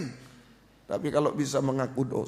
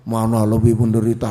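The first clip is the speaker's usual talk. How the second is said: loud, low, booming put-on voice; mock heavenly voice; 4 semitones lower, 10 dB louder than usual. Preacher jokes about hearing God aloud, mock booming voice. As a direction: boom it like a voice from the sky, hammed up. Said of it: "bukan dengar suara ya".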